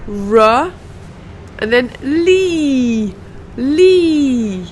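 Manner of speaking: "Tha", "r", "lee" each repeated as isolated sounds; sound drill. Shown as a demonstration. Teacher drilling the sounds of thoroughly one by one. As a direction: drill "Tha" with tongue out, then "r", then a long "lee". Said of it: "with the uh sound together".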